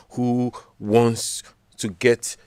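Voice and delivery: ordinary talk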